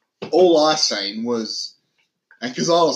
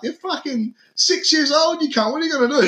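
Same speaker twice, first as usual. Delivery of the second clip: much higher, louder, heated shouting; retold furious yelling; 5 dB louder than usual. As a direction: yell down the old men over the kids. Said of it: "I'm like".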